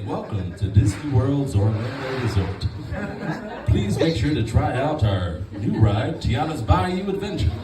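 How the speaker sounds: slow, smooth theme park announcer; slow, polished announcer voice; Disney World announcer. Retold or imitated. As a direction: welcome guests like a park announcer. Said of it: "I actually sound like a Disney World announcer".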